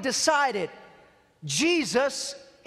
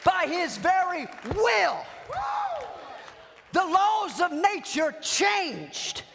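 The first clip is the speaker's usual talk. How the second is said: full-force preaching; 7 semitones higher than usual. Real, not acted. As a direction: preach it up to a fever pitch.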